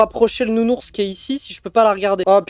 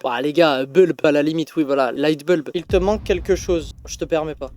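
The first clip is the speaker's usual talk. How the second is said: ordinary talk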